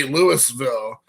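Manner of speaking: Louisville with the S sounded, "LOO-iss-vill"; worse mispronunciation. Shown as a demonstration. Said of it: "Or even worse, if they say".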